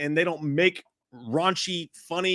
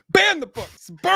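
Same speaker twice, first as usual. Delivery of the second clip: much higher and louder mock rant; 9 semitones higher, 5 dB louder than usual. Joking with Jay about banning things, loud mock rant. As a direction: proclaim it like a crazed preacher.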